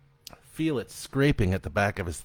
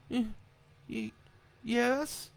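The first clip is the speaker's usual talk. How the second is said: high, halting tearful yes; a crying boy barely answering; stammering child; 3 dB quieter, slower than usual. A narrator's reading. Sobbing boy answers his father, stammering and high.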